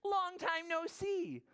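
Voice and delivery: high, drawn-out hare voice; hare's greeting. Acted. Hare greeting an old friend Mouse, high character voice. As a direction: call out as a delighted storybook hare, pitched high. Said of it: "says Hare".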